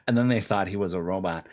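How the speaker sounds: ordinary talk, nothing marked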